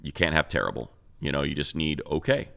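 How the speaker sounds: ordinary talk, nothing marked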